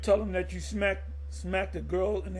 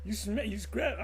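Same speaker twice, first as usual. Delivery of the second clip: mock angry wife; 4 semitones higher than usual. Woman confronts man, acted.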